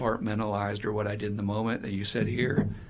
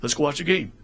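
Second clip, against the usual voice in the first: a hint of a brushing-off buddy, slightly louder and quick; dismissive dude; 4 semitones higher, 4 dB louder, faster than usual. Buddy imitated brushing off tears, lightly voiced and a bit louder. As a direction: rattle off a tough guy's brush-off. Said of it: "not go".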